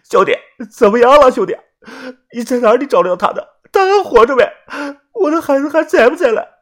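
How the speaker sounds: high, loud voice choking on tears; choked-up pleading. A narrator's reading. Yuanbao asking his brother about his wife, high and choking up. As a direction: beg for news, near tears. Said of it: "元宝的嗓音哽咽，完全是哭着说出来的".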